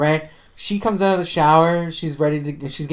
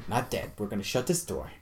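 much softer, lower mock whisper-talk; nervous hush; 4 semitones lower, 10 dB quieter than usual. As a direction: soothe yourself quietly, scared. Retold nervous self-calming.

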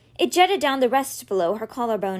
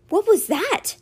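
high, alarmed cry of surprise; a sudden startled what was that; startled question; 10 semitones higher, 3 dB louder, slower than usual. Acted. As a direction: ask it sharply high, caught off guard.

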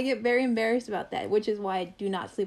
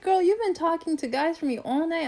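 higher-pitched imitation of an exasperated friend; friend's protest; 6 semitones higher than usual. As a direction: voice the friend pushing back, pitched up. Friend protesting her refusal.

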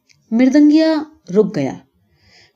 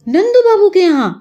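startled question; 10 semitones higher, 3 dB louder than usual. Acted. Old man startled by the name.

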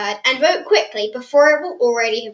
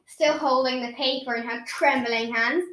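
usual speaking voice

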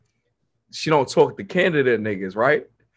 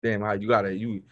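lower, quieter, taken aback; caught off guard; 8 semitones lower, 7 dB quieter than usual. Real, not acted. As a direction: sound stung and caught out. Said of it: "you caught me off guard".

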